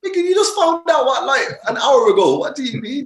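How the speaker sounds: high, loud outraged disbelief; can't believe how fast she moved; incredulous. Retold or imitated. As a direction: son to his mum, high shrill outrage. Incredulous at mum's speed.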